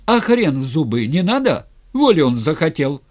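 loud, high scornful retort; flaring up. A narrator's reading. Styr as tsar mocking the wish for freedom, flaring up. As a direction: throw the demand back with indignation. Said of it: "вскинулся Стырь".

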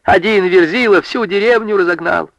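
high, scornful rebuke; high-pitched indignant scorn; indignant scolding. A narrator's reading. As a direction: shame the lads, high and cross. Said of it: "Затопала на парней Анка".

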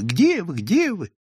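mild narrated shout; mock yell. A narrator's reading. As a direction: call out searching, voice held back. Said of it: "крича изо всей силы".

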